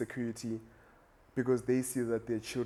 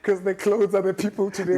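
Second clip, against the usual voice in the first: laughing; 7 semitones higher, 9 dB louder than usual. Real, not acted. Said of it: "That's maximum sarcasm".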